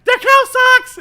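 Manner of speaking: very high, loud squeal; mock squeal. Office lady shrieking over her cows. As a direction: shriek it like a cartoon fan. Mock shrill cow-lover outburst.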